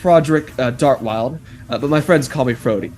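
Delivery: normal talking voice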